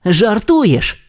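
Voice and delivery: high, doubtful race car voice; disbelieving. Acted. Race car doubts his friend's story, high and disbelieving. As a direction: scoff like a cocky race car. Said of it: "не повірив Блискавка".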